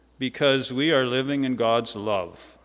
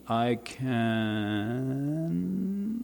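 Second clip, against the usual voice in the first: "I can" with "can" stretched long, softer, gliding upward; unfinished sentence prompt; 7 dB quieter than usual. Shown as a demonstration. Preacher asks congregation to continue the sentence, "can" held open. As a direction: leave "can" hanging and rising for listeners to fill in. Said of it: "how would you continue this sentence".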